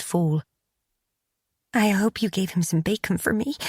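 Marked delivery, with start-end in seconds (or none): 1.7-3.6 a light crack on the final word; voice breaking. A narrator's reading. Lucy asks Bet about the dog, voice breaking at the end. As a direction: hold it together until the voice breaks at the end. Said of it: "her voice broke on the last word".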